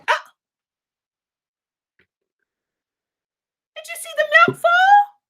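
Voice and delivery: high, loud startled cry; shocked gasp. Real, not acted. Teller to the children as the milk spills, loud and startled.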